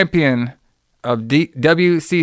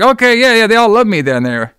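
excited boast; 7 semitones higher, 4 dB louder than usual. Retold or imitated. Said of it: "He's like".

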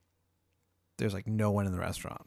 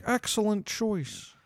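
high, slow approving waiter; 9 semitones higher, slower than usual. Acted. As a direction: approve the order slowly in a high voice. Waiter voiced in the story.

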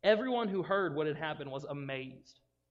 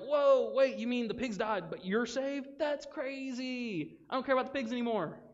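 much higher, hammed-up astonishment; acted amazement; 8 semitones higher, 3 dB louder than usual. Townspeople hearing he is saved, acted amazement. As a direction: gasp it out in high mock disbelief. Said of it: "they're amazed".

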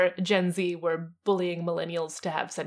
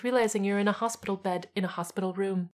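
usual speaking voice

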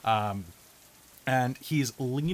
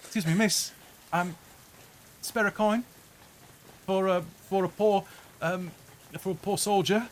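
put-on higher British accent, pleading beggar; a British soldier begging for a coin; 8 semitones higher than usual. Legless soldier begging a passerby for coins, British accent. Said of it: "He's British now".

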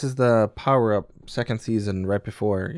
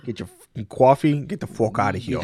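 hammed-up Boston accent; broad fake Boston accent on every vowel; terrible Boston accent. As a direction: do a bad Boston tough talker. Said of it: "terrible Boston accents".

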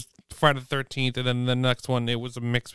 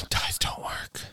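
a weary sigh, then whisper it slowly; 5 dB quieter, slower than usual. Real, not acted. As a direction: give up on them in a slow breathy whisper. Sighing at the tie again.

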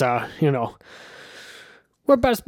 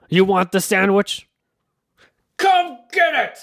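slow, raised challenge building to a high yell; taunting shout; 5 semitones higher, 4 dB louder, slower than usual. Acted. Man dares pigeon to take sandwich, building to a shout. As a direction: draw it out, then holler the dare.

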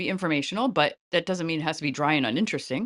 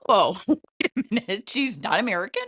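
higher-pitched, replayed astonishment with a laugh; retold surprise; 4 semitones higher, 3 dB louder than usual. Actor's interview reveals she isn't American, retold surprise. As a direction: act out being caught off guard, laughing.

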